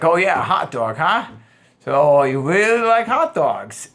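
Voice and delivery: light, amused, slow question; playful cocky teasing. Shown as a demonstration. The right way to tease her about hot dogs, playful and cocky. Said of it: "But if you're like".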